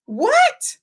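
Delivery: high, loud shocked "what"; sharp rise in disbelief, playing an upset mother; upset mock yell. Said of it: "she's screaming, yelling, she's upset".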